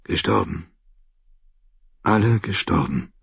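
faint, weary thought; quiet, drained murmur. A narrator's reading. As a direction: say it dully, worn out. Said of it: "dachte er matt".

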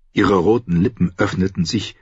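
usual speaking voice

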